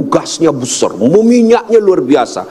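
ordinary talk

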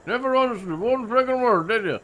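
put-on thick rural accent, words mumbled together. Stranger at a gas station in a thick rural accent. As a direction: talk like there's something in your mouth, thick rural accent. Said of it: "You're talking like you got something in your mouth".

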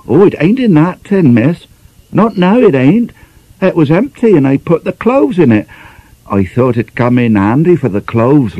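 a hint of a cockney servant girl; lightly voiced young maid. Maid explaining the tin to her mistress, mildly voiced.

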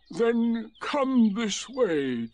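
very slow, high donkey voice; gloomy donkey. A narrator's reading. Donkey leading the newcomer off, slow and resigned. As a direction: stretch each word with resignation.

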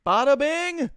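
high, loud bada bing; loud gleeful cheer; triumphant. Real, not acted. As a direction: crow it out like a win. Triumphant exclamation at a play.

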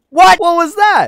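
high, loud startled yelp. Real, not acted. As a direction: jump at it in shock. Startled by a sudden kill.

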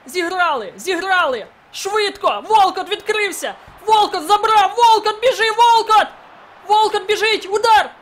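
high, loud ecstatic screaming; screaming like a maniac. Real, not acted. Gamer screaming as the winning goal goes in. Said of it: "що я кричу як псих".

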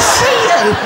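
high, clipped threat; mock gangster line; menacing robber. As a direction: act the hard man saying it. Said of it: "He went".

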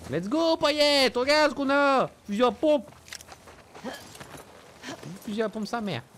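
high, loud celebration; excited. Real, not acted. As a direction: cheer loudly over the loot. Excited about shotgun.

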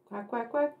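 soft quacking duck greeting. Acted. Little Duck greeting the chickens at the door, soft quacks.

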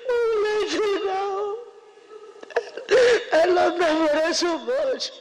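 high, tearful and shaky-voiced grief; crying. Real, not acted. Daughter grieving as her mother is taken away, crying. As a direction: speak through real tears.

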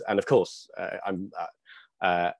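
normal talking voice

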